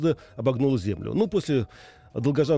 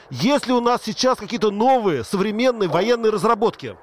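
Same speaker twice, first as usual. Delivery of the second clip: loud, raised voice over noise; to someone hard of hearing; 8 semitones higher, 5 dB louder than usual. Real, not acted. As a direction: speak up for a bad line. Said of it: "Повторите громче". (громко, чтобы перекричать шум)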